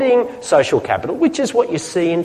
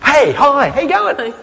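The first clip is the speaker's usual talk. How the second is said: high, lively, laughing hello; enthusiastic caring greeting; 9 semitones higher than usual. Shown as a demonstration. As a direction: greet them as if thrilled to see them. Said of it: "same words. What was different?".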